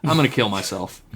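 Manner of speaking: ordinary delivery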